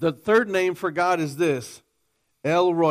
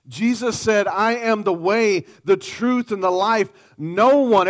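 ringing, slightly raised preaching voice; preaching at full force; 3 semitones higher, 4 dB louder than usual. Acted. Preacher voicing God's claims to the congregation, fervently. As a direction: proclaim it with full conviction.

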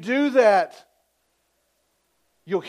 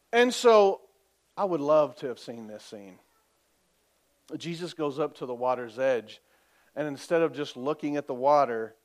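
usual speaking voice

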